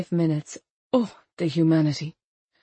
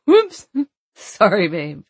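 tipsy laughing whoops; 6 dB louder, slower than usual. Acted. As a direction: laugh it off after stumbling. Drunk stumble, laughing apology.

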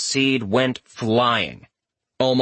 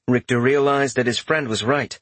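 usual speaking voice

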